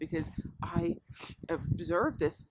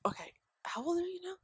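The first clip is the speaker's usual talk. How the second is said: mock exasperation; 10 semitones higher, 4 dB quieter, faster than usual. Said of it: "I'm like".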